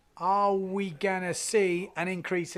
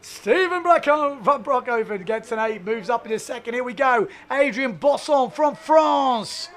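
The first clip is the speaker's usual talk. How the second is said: loud, raised-pitch live announcing; big booming call of the score and next rider; excited sports announcer; 6 semitones higher, 10 dB louder than usual. Real, not acted.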